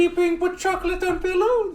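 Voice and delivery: mock maid voice. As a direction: pipe it up like a timid maid. Quoting movie maid voice.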